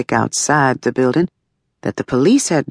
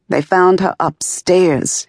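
a touch of crying; 6 semitones higher, slower than usual. Retold or imitated. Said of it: "he cried".

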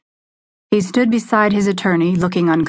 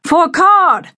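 loud, high booming call of the name; 12 semitones higher, 6 dB louder than usual. A narrator's reading. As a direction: boom the name after him. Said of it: "Hooker's voice boomed".

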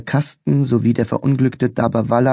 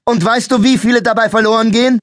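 much higher, louder, fast angry question; angry shout; 12 semitones higher, 5 dB louder, faster than usual. A narrator's reading. Policeman interrogating the cowering boy, angry shout. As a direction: keep yelling at him.

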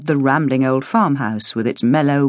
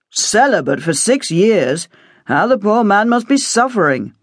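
understated mock pity; 5 semitones higher than usual. A narrator's reading. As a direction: feign sympathy to tease. Simon jokes.

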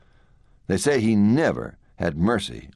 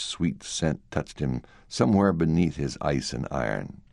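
plain reading voice

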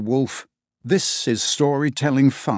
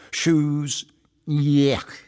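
a hint of disgust on yuck; mock disgust; 3 semitones higher than usual. Shown as a demonstration. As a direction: say yuck like a kid turning up his nose. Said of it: "means something like".

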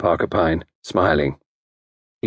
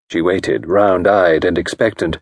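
ordinary delivery

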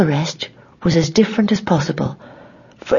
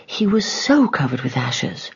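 ordinary delivery

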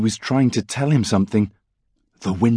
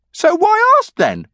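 cheeky retort; 4 dB louder, slower than usual. A narrator's reading. Dave retorts.

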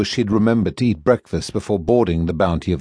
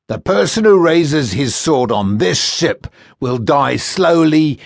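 menacing first mate; 3 semitones higher than usual. Acted. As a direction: draw out the threat like a cruel jailer. First mate threatens prisoners.

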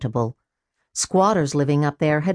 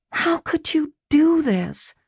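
plain reading voice

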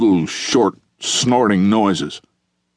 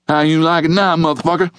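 higher-pitched menacing taunt; 7 semitones higher, faster than usual. Acted. Taji to the john with rope round his neck, taunting. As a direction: rub it in, pitched up.

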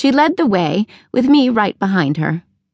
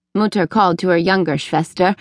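ordinary delivery

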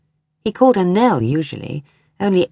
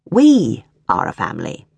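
lower, firm husband's statement; a husband settling the argument, lower and firm; stated flatly; 6 semitones lower than usual. A narrator's reading. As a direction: lay it down as fact. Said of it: "he stated now".

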